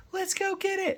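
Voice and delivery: very high-pitched pretend excitement; squeaky, hammed-up charge call; mock eager rallying cry. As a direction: imitate players eager to give chase. Imitates players eager to give chase.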